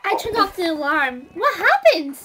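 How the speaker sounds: loud worried call. Acted. Girl returns from the alarm, loud and worried. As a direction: call it out anxiously.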